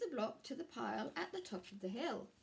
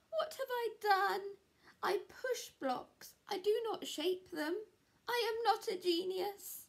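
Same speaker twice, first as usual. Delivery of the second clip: much higher, louder despairing lament; despairing Square; 10 semitones higher, 5 dB louder than usual. Acted. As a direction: wail your failure.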